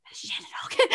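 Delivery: hushed, breathless whisper to mom; excited whisper. Retold or imitated. Whispering to mom that a celebrity sat down. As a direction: whisper it so she won't hear.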